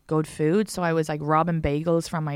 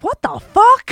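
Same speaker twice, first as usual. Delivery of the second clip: high, loud mock shock; imitating people's shocked outburst; shocked reaction; 11 dB louder, slower than usual. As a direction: blurt it out stunned. People reacting to her story.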